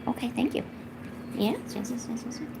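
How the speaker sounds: normal talking voice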